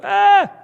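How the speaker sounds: a sudden high yelp of fright; terrified cry. Acted. Disciples seeing a ghost on the lake, frightened scream. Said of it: "They cried out".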